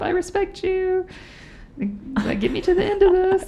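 very high, sing-song mock greeting; squeaky, over-eager appeasing voice; placating a ghost. Rider greeting George to survive the ride, high-pitched pleading. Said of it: "I'm like".

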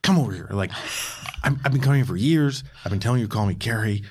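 mild mock exasperation; steamed complaint. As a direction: vent a long-held grievance. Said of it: "Gary's just getting real steamed".

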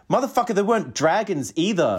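high-pitched, laughing indignation; hammed-up exasperated comeback; mock outrage. Arguing about diversity in fantasy, mock outrage. Retelling an argument about Game of Thrones.

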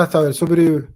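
ordinary talk, nothing marked

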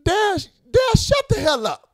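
high, indignant comic outburst; exasperated rant. Real, not acted. Ranter telling Dad to shut up, exasperated. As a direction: shut them down, pitched high.

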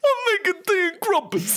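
very high goofy cartoon voice; silly mocking voice. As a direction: mimic the character's ridiculous call. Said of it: "What the fuck is wrong with his voice?".

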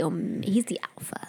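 whispered, half-voiced aside. Real, not acted. Host whispering to cohost that he's the alpha. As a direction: breathe it out as a secret.

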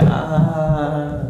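high, loud, long "aaah"; acted-out high, drawn cry of "ah"; drawn-out "ahh" reaction. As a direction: let out a loud mock "aaah". Mimics own reaction to smoke offer.

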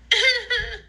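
short high-pitched giggle; quick, high laugh. Real, not acted.

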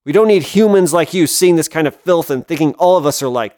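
through clenched teeth, loud; seething, building to a loud rant; shaking with anger. Acted. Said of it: "through clenched teeth".

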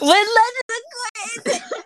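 high, slow dramatic wail. Pretend. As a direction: wail it out like a heartbroken fan. Dramatic fandom confession.

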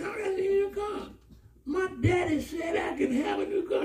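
high, shouted delight; excited wife. Retold or imitated. Wife tells husband about the new car, excited. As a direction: burst out with the news. Wife's excited news, retold.

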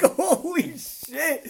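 drawn-out holy shit through a laugh; laughing disbelief. Retold or imitated. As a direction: stretch it slowly while laughing. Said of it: "And you're like".

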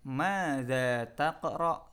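"Madza taqra" dieja sangat lambat; contoh lambat. Shown as a demonstration. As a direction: ucapkan pelan untuk murid. (contoh diucapkan sangat lambat)

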